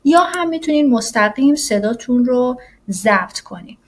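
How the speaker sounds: ordinary talk, nothing marked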